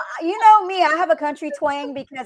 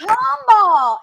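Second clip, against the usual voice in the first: louder, higher, drawn out; emphatic correction; 7 semitones higher, 7 dB louder than usual. Retold or imitated. Correcting the band who misheard, loud emphatic call. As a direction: shout the name clearly so they get it.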